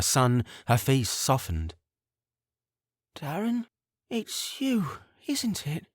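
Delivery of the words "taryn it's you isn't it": high, soft, wondering mother's voice; a waking mother, softly recognizing her son; tender mother voice. A narrator's reading. Mother softly realizing her son is back.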